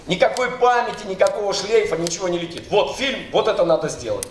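ordinary talk, nothing marked

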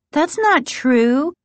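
slow, high, emphatic denial; insistent denial. A narrator's reading. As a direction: deny it firmly, stretching the words out. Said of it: "Margaret insisted".

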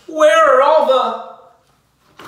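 high, loud, slow alarm. Acted. Narrator panicking at the missing letters, high and loud. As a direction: panic as she notices.

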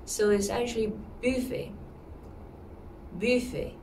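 buffet with a rounded French U. Shown as a demonstration. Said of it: "It has a U sound".

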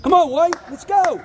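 cheering shout. Real, not acted. Parent cheering at basketball game.

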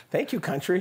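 high, delighted mock-customer voice; sweet customers. Sweet diners thanking the cook, high imitated voice. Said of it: "these sweet people would be like".

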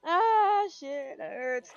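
usual speaking voice

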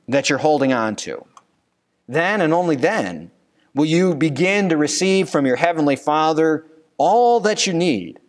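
normal talking voice

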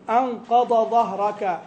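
"أنقض ظهرك" slow, ض and ظ kept distinct; clear dad and zha. Shown as a demonstration. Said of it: "تبين الضاد من الظاء". (نطق واضح يميز الضاد من الظاء)

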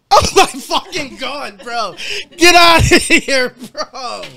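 drawn-out, high, disbelieving exclamation; bugging out. Real, not acted. Streamer stunned by fans' generous support, bugging out. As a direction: stretch it out high, overwhelmed and amazed. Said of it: "I'm about to fucking bug out".

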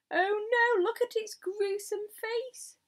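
high wail, loud at the start; horrified cry. A narrator's reading. Police chief seeing the gruesome face, horrified cry. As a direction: recoil aloud in horror. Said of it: "cried the chief of police".

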